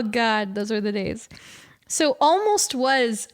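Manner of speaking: ordinary talk, nothing marked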